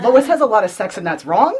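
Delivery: high, loud mock disapproval; mock judgement. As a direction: pronounce it like a comic scold. Mocks how the comic judges Lois.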